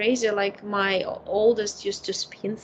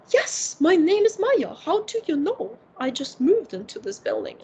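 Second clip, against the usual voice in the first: higher, lightly voiced German accent; imitating an old lady's German accent, pitched up; 7 semitones higher than usual. Old grandma in the lobby answers a child, German accent. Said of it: "with this German accent".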